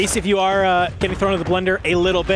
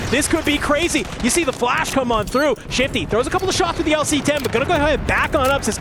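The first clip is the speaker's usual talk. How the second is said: ordinary talk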